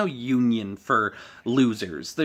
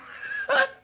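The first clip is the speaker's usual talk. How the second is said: squealing laugh. Real, not acted. Laughing at own impression.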